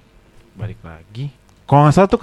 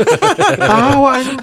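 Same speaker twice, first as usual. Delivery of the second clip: very slow high laughing word; 9 semitones higher, 3 dB louder, much slower than usual. Real, not acted. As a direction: stretch it out laughing. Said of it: "ketawa lagi". (tertawa panjang dengan nada tinggi)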